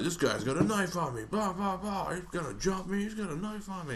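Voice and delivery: high-pitched mock yell; retold yelling. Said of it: "the guy was, like, yelling this".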